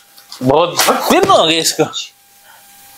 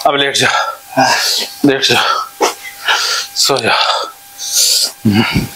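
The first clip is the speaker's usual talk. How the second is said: soothing calm-down; 8 semitones lower, slower than usual. Real, not acted. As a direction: ease him down to lie still. Healer settling patient down to rest.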